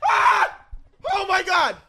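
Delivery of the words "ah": high sharp burning yelp; pained scream. Real, not acted. Friend burning from a hot chip, pained scream. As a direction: shriek from the spice.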